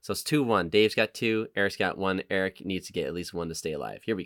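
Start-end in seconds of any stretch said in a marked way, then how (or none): none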